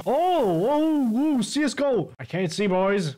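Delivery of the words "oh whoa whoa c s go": high startled cries; startled whoa. Real, not acted. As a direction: caught off guard in smoke. Smoke engulfs him.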